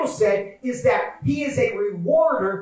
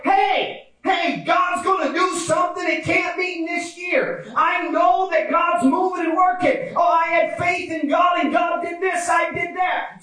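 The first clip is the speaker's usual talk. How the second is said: high, fervent preacher's shout; rally a friend with raised pitch; excited encouragement; 8 semitones higher than usual. Acted. Believers encouraging each other about God, excited high-pitched shouting. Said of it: "we were encouraging each other".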